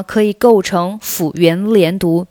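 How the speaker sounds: ordinary talk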